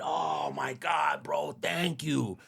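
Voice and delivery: drawn-out, rising fan excitement; thrilled gushing. Acted. Young fan thanking Big Boy for the game, gushing with excitement. Acting his thrilled phone reply.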